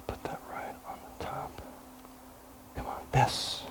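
hushed whisper while focused on a task; a barely voiced whisper. Real, not acted. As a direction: murmur under the breath as you place it. Quietly placing food on brownie.

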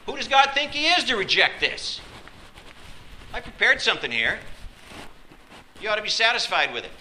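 high, loud, offended protest; indignant anger. Acted. Offended worshipper protesting God's rejection, indignant. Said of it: "Will you respond with anger".

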